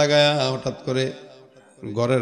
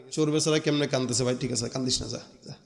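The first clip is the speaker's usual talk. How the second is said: pitying; 2 semitones lower, 6 dB quieter, faster than usual. Acted. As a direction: gently let a poor wretch go. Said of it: "Abu হুরায়রার দিলে মায়া লেগে গেছে". (মায়াভরা নিচু গলায় সান্ত্বনা)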